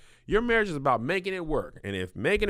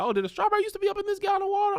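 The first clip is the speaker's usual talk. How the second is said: mock wife; much faster than usual. Mocking imagined wife.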